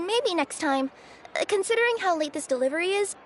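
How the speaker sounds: usual speaking voice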